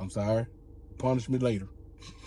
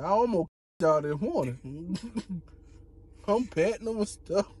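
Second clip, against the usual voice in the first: ordinary delivery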